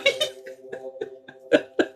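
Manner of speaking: high breathy giggle; gentle giggle. Real, not acted. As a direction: giggle fondly. Laughs about Kermit the Frog.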